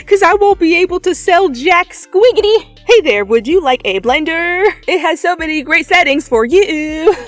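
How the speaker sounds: high, loud hammed-up shopping channel pitch; TV salesperson. Reader mocks herself as a shopping channel seller, hammed-up pitch. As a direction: sell it like a cheesy TV host. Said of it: "Don't ever put me on the Home Shopping Network".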